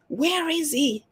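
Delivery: high, drawn-out searching question; searching aloud. Real, not acted. Reader hunting for her card, searching aloud.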